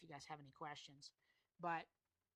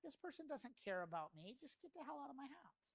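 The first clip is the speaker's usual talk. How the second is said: higher-pitched mock customer grumble; lightly acted irritated customer; put-off customer; 8 semitones higher than usual. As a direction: voice a customer who wants the salesman gone. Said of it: "They're like".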